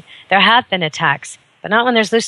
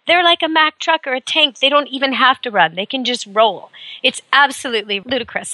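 normal talking voice

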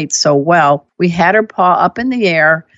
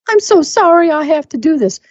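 high-pitched, hammed-up apology; put-on anxious owner apologizing, way up high; mock apologetic fretting; 10 semitones higher than usual. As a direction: plead sorry in an exaggerated high voice. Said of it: "help you convince yourself to be calm and in the moment versus".

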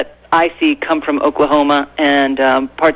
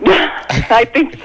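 laughing; 8 semitones higher, much slower than usual. Real, not acted.

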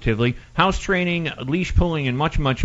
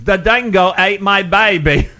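louder, slow, laughing mock Australian accent; quoted Australian line; 6 semitones higher, 7 dB louder, slower than usual. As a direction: quote the musical's line in a hammed-up Aussie accent. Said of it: "that was one of the lines".

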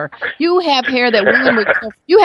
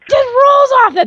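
delighted; 3 dB louder than usual. Real, not acted. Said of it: "it sounds so good when you say it".

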